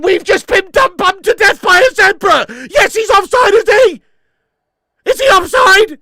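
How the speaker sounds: loud, high alarmed yelling; panicked shout. Real, not acted. Fan seeing the opponents score, panicked shout. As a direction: shout in dismay and hope.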